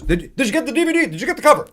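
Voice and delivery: high, insistent questions; imitated excited checking; eager calling out. Mel calls out while leaving.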